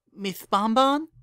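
high, lisping little filly voice; lispy filly. A narrator's reading. Curly-haired filly calls her boss from the kitchen, lispy voice. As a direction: pipe up with a childlike lisp. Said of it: "came a lispy voice from the kitchen".

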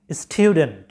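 স্টুডেন্ট as styu-dent, with a y-glide; correct pronunciation. Shown as a demonstration. Teacher corrects students' word, correct pronunciation. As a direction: model স্টুডেন্ট with "tyu", falling. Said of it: "সঠিক উচ্চারণটা হচ্ছে".

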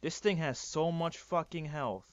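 ordinary delivery

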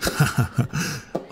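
a soft, airy, rising chuckle under the breath; breathy chuckle. Acted. Student watching his crush rush for the elevator, breathy chuckle. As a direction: laugh quietly to himself, amused.

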